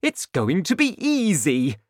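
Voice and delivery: high, loud, pleased exclamation; delighted father. A narrator's reading. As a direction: relish your success.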